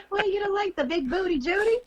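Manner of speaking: playful high. Real, not acted.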